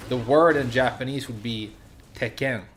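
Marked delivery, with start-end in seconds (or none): 2.1-2.6 "Tekken" run together with a single short k, "te-ken"; no consonant emphasis. Shown as a demonstration. Teacher showing the word without small tsu, single-k "Tekken". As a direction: say "Tekken" without any gap before the k. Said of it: "If you didn't have that emphasis".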